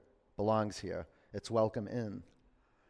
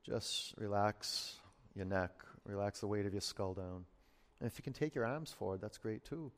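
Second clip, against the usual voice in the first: soothing massage murmur; 5 dB quieter than usual. Acted. Slow relaxation cues.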